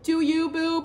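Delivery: high, sassy do-you. Retold or imitated. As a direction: toss it off like a bestie. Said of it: "I literally was just like".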